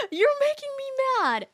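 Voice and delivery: high, petulant mock whine; mock tantrum from the defensive mom. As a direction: sulk like a scolded child. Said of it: "she replied with".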